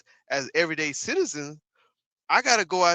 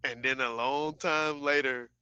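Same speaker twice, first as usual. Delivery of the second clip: slow, lower, mocking echo of the phrase; mock Trump; 4 semitones lower, 5 dB quieter, slower than usual. Streamer mimicking Trump's rambling line, slow and lower. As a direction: drag it out like the clip, lower.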